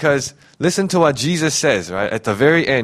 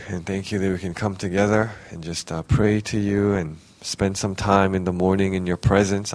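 soft, hushed prayer, fading lower; 5 semitones lower, 5 dB quieter than usual. Real, not acted. Pastor praying with the congregation, soft prayer voice. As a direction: pray quietly for the morning.

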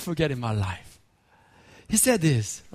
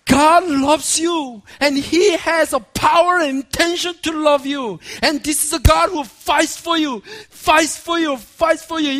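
full-force sermon delivery; preaching; 7 semitones higher than usual. Acted. Pastor urging the church, preaching at full force. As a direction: preach it to the congregation.

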